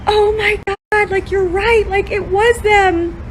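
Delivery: very high, drawn-out astonished exclamation; retold shock. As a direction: act out a stunned, slow realization. Retells her own shocked realization.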